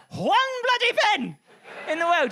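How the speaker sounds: high, slow disbelief; incredulous. Real, not acted. Comic mocking a footballer, high slow incredulous. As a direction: stretch it out in disbelief.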